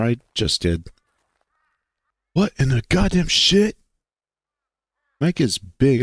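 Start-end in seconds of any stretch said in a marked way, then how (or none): 2.3-3.8 alarmed, words caught in throat; startled squeak of alarm; higher pitched. Acted. Micah startled at being caught, higher-pitched alarm. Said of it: "higher pitched than his others".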